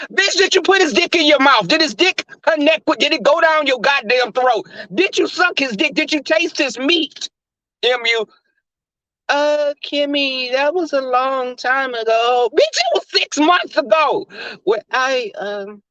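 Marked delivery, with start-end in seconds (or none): 0.1-7.3 higher, pressing questions; pitched-up incredulous demands; exasperated grilling. Acted. Kimmy grilling her. 9.3-12.5 slow stalling. Acted. M U stalling slowly.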